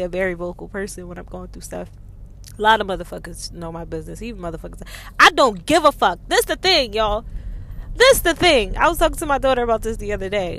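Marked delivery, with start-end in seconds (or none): 5.2-8.7 loud, higher, fired-up declaration; emphatic. Real, not acted. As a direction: hammer it home to the crowd.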